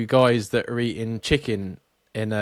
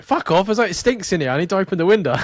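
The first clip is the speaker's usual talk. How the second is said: higher, louder mock irate retort; exasperated; 8 semitones higher, 5 dB louder, faster than usual. Man telling Karen at his window, exasperated. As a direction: snap back at an intruder in a retold row.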